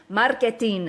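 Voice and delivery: "Marketing" with a tapped r and Italian vowels; Italian-accented "Marketing" with a hard t. Retold or imitated. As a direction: say "Marketing" in an Italian accent, every syllable sounded. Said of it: "like, an Italian accent".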